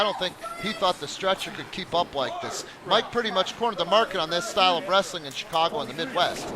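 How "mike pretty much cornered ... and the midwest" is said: usual speaking voice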